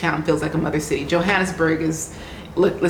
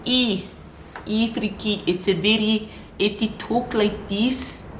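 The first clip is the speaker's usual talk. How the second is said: high, slow South African accent attempt; exaggerated South African vowels, drawn out; very bad South African accent; 4 semitones higher than usual. Shown as a demonstration. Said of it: "that's a very bad accent".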